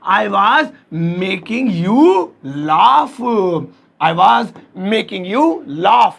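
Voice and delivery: drawn out with pauses between chunks; feed the sentence slowly, piece by piece; slow dictation. Shown as a demonstration. Teacher dictating a translation to students, slow dictation.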